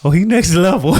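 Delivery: high, loud imitation of the wife's voice; a teasing impression of his wife; wife's gushing praise. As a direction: play the wife bragging about her husband. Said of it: "she'll tell you, like".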